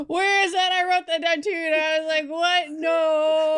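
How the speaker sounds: loud, high, incredulous outburst; excited disbelief. Real, not acted. Co-host wrote it down too, loud excited disbelief. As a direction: burst out in amused disbelief. Excited agreement over baffling line.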